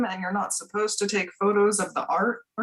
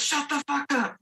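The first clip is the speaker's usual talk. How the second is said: rapper imitation; 6 semitones higher, 3 dB quieter than usual. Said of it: "Simon Says".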